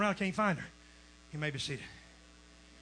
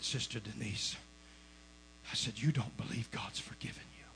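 tender hush; 10 semitones lower, 12 dB quieter than usual. Retold or imitated. Bedside talk to sick woman.

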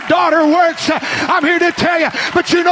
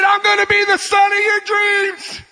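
high, fervent cry; impassioned declaration; 6 semitones higher than usual. Retold or imitated. Son announcing his call to his dad, high cry.

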